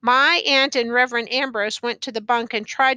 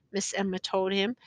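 plain reading voice

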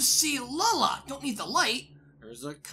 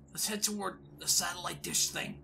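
normal talking voice

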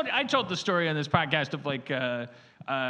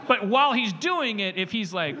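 usual speaking voice

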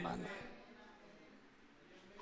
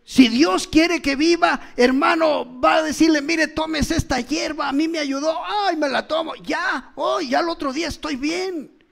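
rising, loud preaching; fervent pulpit delivery; 3 semitones higher, 3 dB louder than usual. Acted. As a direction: preach it out with force. Preacher at full force.

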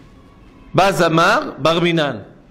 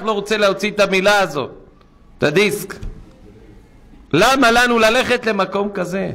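ordinary talk, nothing marked

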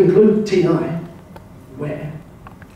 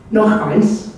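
a mildly nervous, raised "Noch eins"; half-hearted mock nerves, higher pitch; 6 semitones higher than usual. Lone traveller ordering among Germans, mildly nervous. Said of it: "he said nervously".

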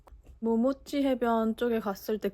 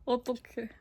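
usual speaking voice

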